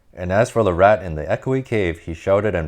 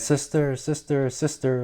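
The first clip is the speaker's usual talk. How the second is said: higher and softer, a distant echoing reply; cave echo; 8 semitones higher, 3 dB quieter than usual. Acted. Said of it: "a voice from the cave came back".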